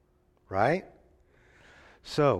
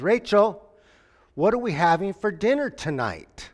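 calling out; 6 semitones higher, 5 dB louder than usual. Retold or imitated. Husband calls down hallway.

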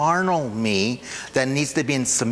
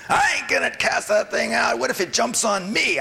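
much higher, scared whiny voice; fearful flesh voice; 10 semitones higher than usual. Acted. Preacher's flesh refusing to cast out a demon, scared whine. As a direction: whine like a coward afraid of the demon. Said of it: "That was my flesh talking".

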